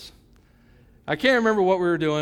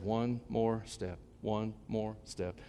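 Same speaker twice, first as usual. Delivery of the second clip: very slow, much softer "One more step"; quiet self-coaching; 5 semitones lower, 10 dB quieter, much slower than usual. Retold or imitated. A boy jogging his first mile, urging himself on softly and slowly. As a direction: breathe "One more step" to yourself, each word slow and soft. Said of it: "and I said".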